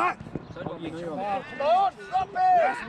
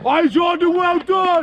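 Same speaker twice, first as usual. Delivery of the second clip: shouting; 3 semitones higher, 9 dB louder, slower than usual. Real, not acted. Coach praising player.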